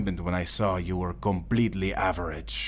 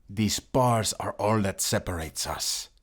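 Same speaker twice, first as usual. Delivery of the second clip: usual speaking voice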